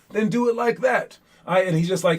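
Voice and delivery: normal talking voice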